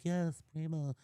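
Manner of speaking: lightly voiced angel on the shoulder; a hint of a sweet tempting angel; angel hot dog voice. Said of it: "angel hot dog".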